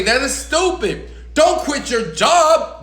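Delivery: high, emphatic mock scolding; disapproving relative. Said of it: "your significant other gonna say".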